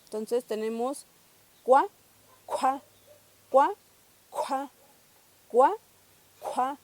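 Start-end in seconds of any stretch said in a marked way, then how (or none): 1.6-6.8 cua with a strong puff of air after the k; aspirated kw. Shown as a demonstration. Teacher drilling an aspirated cua for learners. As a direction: push breath out on the cu of cua. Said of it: "la consonante compleja aspirada".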